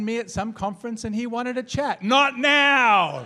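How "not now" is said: loud, high, drawn-out protest; indignant retort. Retold or imitated. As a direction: cry out in exasperated refusal. Retold indignant reply at the urinal.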